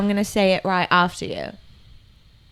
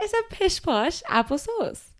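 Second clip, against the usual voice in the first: mock British accent; 7 semitones higher, 6 dB quieter than usual. Shown as a demonstration. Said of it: "British accent challenge".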